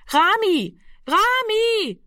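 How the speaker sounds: very high, sustained call. Acted. Sister calls into the darkness for the missing boy, high drawn-out cry. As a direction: call the name out into the dark. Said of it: "rief sie fortgesetzt in die Dunkelheit hinein".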